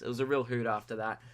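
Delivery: usual speaking voice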